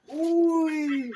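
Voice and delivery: sharp, loud startled exclamation. Real, not acted. Farmer jolted by the cow, loud startled uy. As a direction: blurt out a loud uy in alarm.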